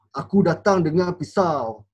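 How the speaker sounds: menacing battle-rap punch; punchy delivery. Shown as a demonstration. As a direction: land the knife line hard and slow. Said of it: "Mana delivery yang lagi best".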